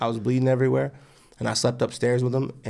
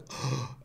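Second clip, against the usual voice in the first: quiet re-enacted gasp; shocked gasp; 11 dB quieter than usual. Husband seeing his wife cut open, acted shocked gasp. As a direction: suck in air, as if shocked.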